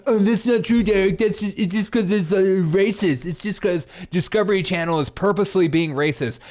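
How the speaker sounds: high, loud, whiny protest; mock objector. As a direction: argue back as an indignant caricature. Mimics an objector arguing back.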